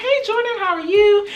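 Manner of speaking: high, sing-out hello to a friend; bubbly greeting. Retold or imitated. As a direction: greet them in a bright high pitch.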